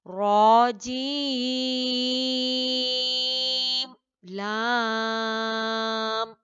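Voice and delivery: long chanted letter names, stopping on the m; Quranic recitation demo. Shown as a demonstration. Teacher demonstrating correct Quran letter recitation to students, chanted. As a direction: recite each letter drawn out, clear meem stop. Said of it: "तो इसे कैसे पढ़ेंगे?".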